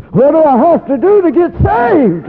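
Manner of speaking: high, loud, urgent cry; desperate plea. Retold or imitated. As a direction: beg to be saved. Friend pleading to be saved.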